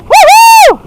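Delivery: high loud woo-hoo whoop. Real, not acted. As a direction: whoop with joy.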